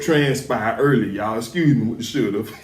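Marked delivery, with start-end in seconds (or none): none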